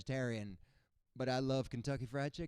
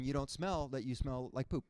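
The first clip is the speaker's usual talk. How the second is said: ordinary talk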